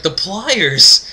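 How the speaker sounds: high, loud mock outcry; hammed-up shriek of alarm; horrified disbelief. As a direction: exclaim it aghast, as a scared kid. Retold horror at the pliers.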